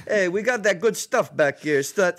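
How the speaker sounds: high, mock Italian-American accent. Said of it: "in an Italian accent".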